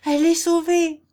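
high, loud exclamation; excited cry. A narrator's reading. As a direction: cry out the good news at high pitch. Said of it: "s'écria le bailli". (cri exalté, aigu et fort)

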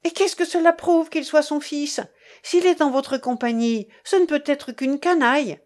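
high, scornful retort; indignant. A narrator's reading. Young woman answering the man who presents the son, indignant. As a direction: throw it back at him with indignation. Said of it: "Elle tremblait toute d'indignation".

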